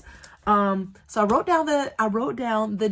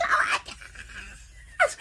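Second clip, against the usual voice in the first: drawn-out voiceless high gasp; silent excited scream; much slower than usual. Retold or imitated. Reading the acceptance email beside her baby, silent excitement. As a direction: mouth it in a slow silent shriek. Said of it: "silent screaming like that".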